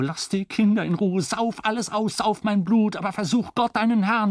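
high, shaking outrage; trembling fury. A narrator's reading. Husband, pale with rage, pleads in high trembling fury. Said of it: "blass vor Wut und begann am ganzen Körper zu zittern".

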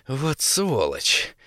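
drawn-out hissed curse; angry hiss. A narrator's reading. Vovka cursing the AI on the radio, hissing angrily. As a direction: seethe it slowly under the breath. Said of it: "гневно зашипел Вовка".

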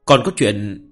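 steady audiobook narration; story narration. A narrator's reading. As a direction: read the chapter aloud evenly. Reads novel chapter aloud.

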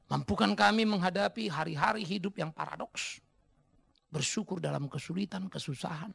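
solemn prayer with long pauses; doa. Acted. Memimpin doa bersama. (doa khidmat, berjeda panjang)

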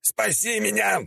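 high, drawn-out cry for help; stretched high-pitched plea; squealing. A narrator's reading. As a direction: squeal in hammed-up panic. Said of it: "завизжал Святогор".

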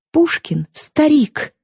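exclaiming. A narrator's reading. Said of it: "вскричала Астра".

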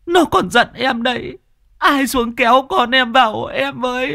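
put-on very high desperate cries to a child; bitter screaming. A narrator's reading. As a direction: scream out bitterly across the water. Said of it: "bà cay đắng mà gào lên".

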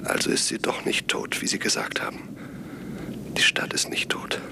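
unvoiced, hushed whisper. Reporter hiding in the dead town tells the professor, whispering. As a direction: keep it secret, breath only.

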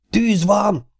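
high-pitched alarmed cry of fire; shouting fire. A narrator's reading. A voice in the night alerting the farm to the fire, shouted high. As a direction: yell it out to warn everyone. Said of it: "kiáltotta egy hang".

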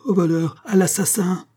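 high-pitched alarm call; frantic yell. A narrator's reading. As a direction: raise the alarm in a panic. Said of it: "hurla le concierge affolé". (cris affolés)

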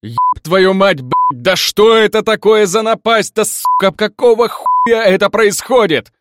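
full-force swearing rant, high and loud; furious high-pitched shouted tirade; outraged outburst. A narrator's reading. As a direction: yell it out in sheer exasperation. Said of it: "Я выдохнул и выдал такое".

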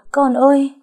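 high, loud cry of alarm; acted scream. A narrator's reading. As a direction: scream out for your child. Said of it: "Tôi nghe bà ta hét lên". (tiếng hét thất thanh)

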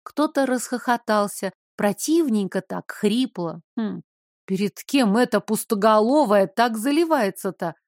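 ordinary delivery

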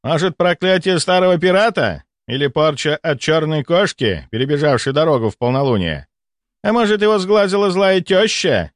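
high, mocking speculation; sarcastic. A narrator's reading. Doctor mocks colleague's mystical hunches, sarcastic. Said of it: "Сарказм в его голосе".

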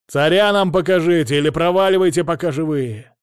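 defiant shout. A narrator's reading.

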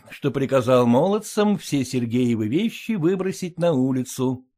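ordinary delivery, nothing marked

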